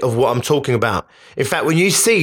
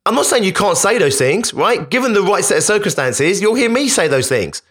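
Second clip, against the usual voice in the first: usual speaking voice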